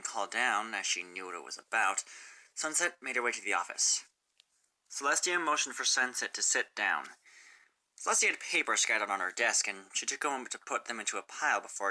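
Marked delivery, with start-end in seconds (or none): none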